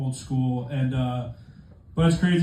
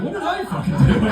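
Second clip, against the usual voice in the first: much higher, louder, panicky whine; panicked whine; 12 semitones higher, 3 dB louder than usual. Retold or imitated. Passenger panicking at the silent ex-girlfriend, high panicked whine. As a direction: squeak it out in nervous panic. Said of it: "and you're like".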